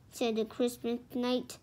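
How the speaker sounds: plain reading voice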